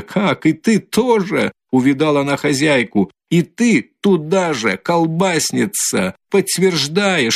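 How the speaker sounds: high, slow, scornful abuse; furious. A narrator's reading. Katerina turning on the landlady, high and furious. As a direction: lash out at the landlady.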